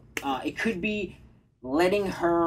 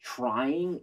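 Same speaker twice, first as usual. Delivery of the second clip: ordinary delivery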